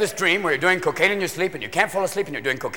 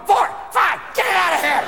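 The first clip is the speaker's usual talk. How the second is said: high, shouted fore call; wired golfer yelling fore; coked-up golfer yell; 9 semitones higher than usual. Retold or imitated. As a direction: bark it down the fairway, wired. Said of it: "golfers going".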